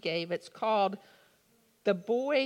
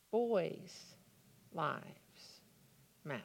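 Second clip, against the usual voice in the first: soft and low, word by word; solemn, hushed; 5 semitones lower, 7 dB quieter, slower than usual. Real, not acted. Preacher giving the slogan no one says, hushed and solemn. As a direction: let each word drop quietly with a pause after it.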